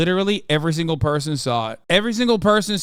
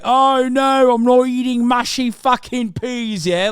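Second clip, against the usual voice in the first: higher, drawn-out mock British accent; 6 semitones higher, slower than usual. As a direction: wail like a hammed-up British mother. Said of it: "like, a British person".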